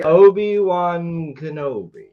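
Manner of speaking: Obi-Wan Kenobi drawn out slowly, every syllable; slow formal full name. Shown as a demonstration. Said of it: "going formal names here".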